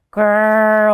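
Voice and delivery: loud, long rumbling growl; a pleased bear's growl. Acted. Bear growls happily at gift.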